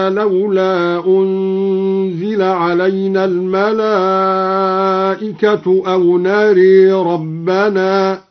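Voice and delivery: slow chant, نري with the vowel leaned toward e; imala on نري. Shown as a demonstration. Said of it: "قرأ الدوري بالإمالة هكذا". (إمالة في نري بتلاوة بطيئة)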